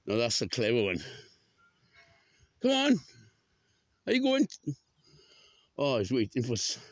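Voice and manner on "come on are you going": high, loud coaxing call; urging call. Real, not acted. Walker urges animals along, high loud call. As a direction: urge them on, high and loud.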